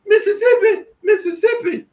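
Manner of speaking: very high, loud mocking squeal; mocking falsetto. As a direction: squeal it high to ridicule them. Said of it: "you hear 'em saying".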